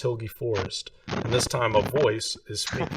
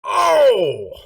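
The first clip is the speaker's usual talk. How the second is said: surprised shout; 15 dB louder than usual. Real, not acted. Reaction to a dice roll.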